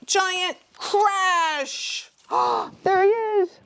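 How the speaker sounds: high loud exclaim; excited shout. A narrator's reading. Climax of story.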